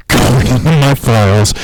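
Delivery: ordinary talk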